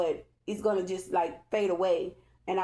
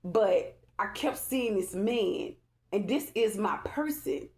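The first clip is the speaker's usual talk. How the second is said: normal talking voice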